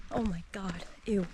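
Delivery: ordinary talk, nothing marked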